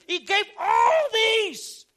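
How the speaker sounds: very loud, high, stretched-out cry; climactic preacher's shout. Real, not acted. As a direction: belt it out with the vowels held long.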